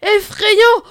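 high, loud cry; loud exclamation. Acted. Elegant wolf topping the others, loud. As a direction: shout it with relish. Wolves compete in outrage.

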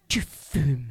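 low, ominous accusation; appalled mother. Retold or imitated. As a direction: imitate a mother who has just found out. Mother's shocked discovery, acted. (mère atterrée, accusation grave)

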